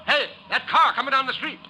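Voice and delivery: alarmed warning shout. Acted. Officer yells to Santa about a speeding car, building to a scream.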